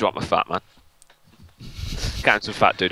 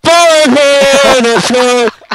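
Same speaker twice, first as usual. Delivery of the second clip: loud slow drawn-out call; shouting; 13 dB louder, much slower than usual. Acted. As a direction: yell it out slowly. Loud call during game play.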